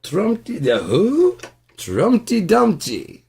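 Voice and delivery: high, loud hammed-up British accent. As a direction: play a pompous British gentleman. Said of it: "the British accent".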